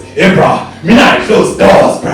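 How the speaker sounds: loud, high barked seal voice; comic seal-man calling out; seal-like Daddy. Retold or imitated. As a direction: bark it slowly like a seal. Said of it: "he sounded like a seal".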